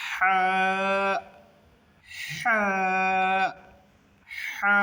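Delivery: হা with a long, squeezed Arabic "ḥ"; pressed throat haa. Shown as a demonstration. Teacher coaching those who can't, হা with gentle throat pressure. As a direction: put slight mid-throat pressure on হা, then hold "aa". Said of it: "একটু pressure দিয়ে এরপরে".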